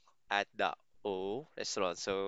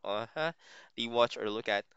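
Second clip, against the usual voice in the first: ordinary delivery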